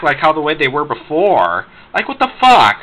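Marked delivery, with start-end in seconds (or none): 1.9-2.8 high, loud exasperated outburst. Real, not acted. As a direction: blurt it out in disbelief. Ranting about Baby Looney Tunes.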